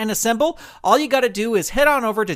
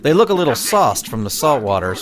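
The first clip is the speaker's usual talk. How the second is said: a lightly voiced lawman, lower and steady; lawman deputy; 11 semitones lower, 4 dB louder than usual. Deputy watches gang leave saloon.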